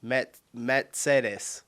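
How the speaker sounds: halting, slow Mercedes as met-SAY-des; false start on 'met', then Mercedes with 'ts'; attempted German pronunciation. Shown as a demonstration. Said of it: "I'm not doing it right".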